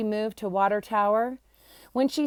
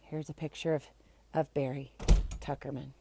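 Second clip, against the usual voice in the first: soft aside; 6 semitones lower, 7 dB quieter than usual. Real, not acted. Reader aside, showing picture.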